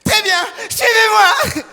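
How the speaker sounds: very high, loud hammed-up hostess yell; slow, high mock-heroic shout; hostess shouting orders. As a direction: play the hostess taking charge, stretched out and shrieked. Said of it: "elle m'a regardé, elle fait".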